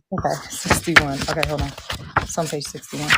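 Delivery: usual speaking voice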